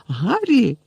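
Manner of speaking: high sharp warning call; warning cry. A narrator's reading. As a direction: call him off urgently. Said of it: "застережливо крикнула Герміона".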